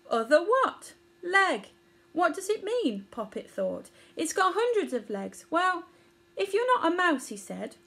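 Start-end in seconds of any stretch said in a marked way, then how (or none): 0.1-3.1 put-on young Poppet voice. Poppet confused by idiom. 4.2-7.3 put-on young Poppet voice. Poppet reasoning aloud.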